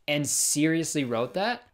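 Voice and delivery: ordinary delivery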